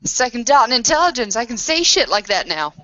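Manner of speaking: loud, pleased exclamation; excited outburst. Real, not acted. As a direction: brag about the new stat out loud. Player excited about a stat.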